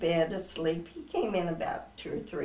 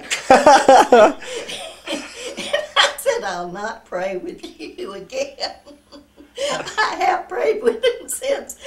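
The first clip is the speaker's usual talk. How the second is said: laughing; 8 semitones higher, 11 dB louder, slower than usual. Real, not acted. Laughing while retelling the punchline.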